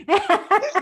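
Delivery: high burst of laughter. Real, not acted. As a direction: laugh out loud at your own joke. Laughs at her own joke.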